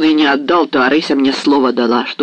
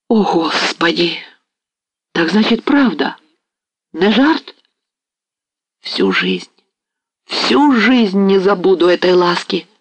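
higher, slow, overwhelmed thanks; stunned with joy; 5 semitones higher, slower than usual. A narrator's reading. Ostap receiving the bride's headdress, stunned with joy. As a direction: gasp it out, dazed by happiness. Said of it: "растерянный от неожиданности и радости Остап".

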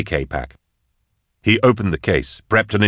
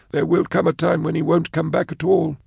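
Blake voice; 11 semitones higher than usual. Acted. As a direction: lift the voice, uneasy and tight. Blake's worried reply.

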